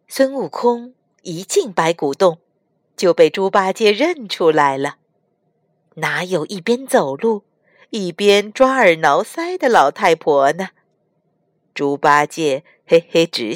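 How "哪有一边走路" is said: ordinary talk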